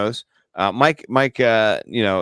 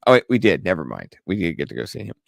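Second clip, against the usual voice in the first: ordinary talk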